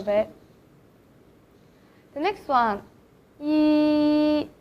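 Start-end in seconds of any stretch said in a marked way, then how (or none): 3.4-4.5 "yi" with lips spread, tongue toward the palate; a clean, bright-vowel "yi". Shown as a demonstration. Teacher modelling the Mandarin vowel for learners. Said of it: "for this sound you have to spread your lips".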